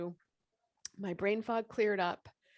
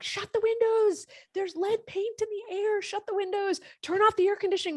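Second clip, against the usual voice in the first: high, loud panicked shouting; frantic yelling; 13 semitones higher, 8 dB louder than usual. Retold or imitated. Telling her family to shut the windows, panicked shout. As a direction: yell it in a panic, much higher and louder. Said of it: "I'm freaking out".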